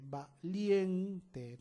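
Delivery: valiente broken into va, LIEN, te; syllable by syllable. Shown as a demonstration. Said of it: "dónde está el énfasis".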